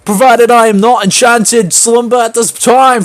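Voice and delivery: put-on high, slow, singsong British accent. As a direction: lilt it high in mock British. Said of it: "very British".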